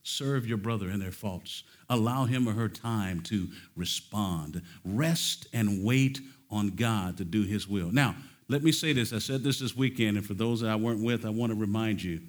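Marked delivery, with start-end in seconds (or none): none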